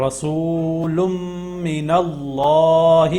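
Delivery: اللہ with a thick, full-mouthed double L; رسول من اللہ slow and chanted, with اللہ heavy; heavy Allah. Shown as a demonstration. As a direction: read اللہ heavy after the zabar, drawn out and sung. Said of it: "ہم اس کو موٹا پڑھیں گے".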